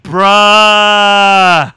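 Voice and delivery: loud, long-held, hammed-up exclamation; stunned reaction. Host voices Jack hearing about his father, mock stunned. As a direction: drag it out in mock disbelief.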